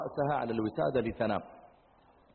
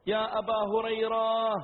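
high, drawn-out call; mother calling out; 7 semitones higher, slower than usual. Acted. Mother calls her son Abu Hurayra, calling out. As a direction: call to someone in another room. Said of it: "نادته أمه يوما".

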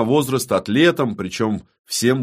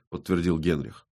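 ordinary delivery, nothing marked

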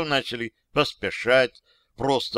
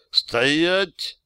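higher-pitched, moderately raised shout; shouted command; 6 semitones higher, 4 dB louder than usual. A narrator's reading. Narrator halting the advancing soldiers, mild commanding shout. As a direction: halt them with a lightly shouted order. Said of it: "крикнул я".

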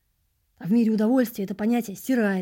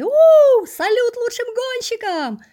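high, slow stadium yell; crowd roar; 10 dB louder, slower than usual. A narrator's reading. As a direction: roar it over the fireworks. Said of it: "заорали зрители".

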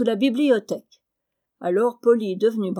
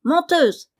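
high, louder squawk; sharp parrot screech, higher and louder; parrot cry; 7 semitones higher, 5 dB louder than usual. Acted. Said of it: "s'écria Polly".

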